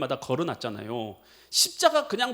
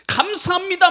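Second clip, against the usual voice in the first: loud thanks; 12 semitones higher, 10 dB louder than usual. Retold or imitated. Thanks cried out.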